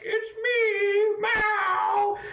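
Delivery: very high, loud cartoon dictator cry; triumphant Mao. Streamer voicing Mao in an imagined sketch, high and loud. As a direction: announce yourself like a villain rising over mountains. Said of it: "being like".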